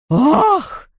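high, startled "oh"; shocked exclamation; stunned gasp. A narrator's reading. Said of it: "Баронесса ошеломлённо отпрянула".

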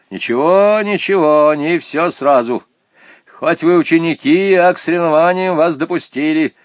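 strict bureaucrat laying down instructions; stern official. A narrator's reading. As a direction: brush off objections and dictate orders. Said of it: "строго говорил Ирине Вадимовне".

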